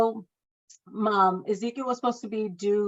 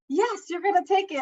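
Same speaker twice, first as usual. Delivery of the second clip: insisting; 12 semitones higher, 4 dB louder than usual. Retold or imitated. Coworker insists.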